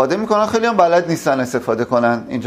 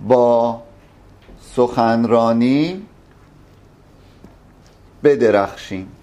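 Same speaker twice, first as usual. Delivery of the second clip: slow dictation; 4 semitones lower, much slower than usual. Shown as a demonstration.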